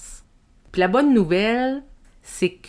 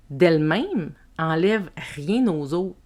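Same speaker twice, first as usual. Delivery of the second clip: ordinary talk, nothing marked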